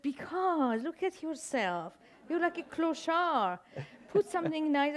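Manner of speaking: a mock scold from the daughter. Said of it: "And she said that".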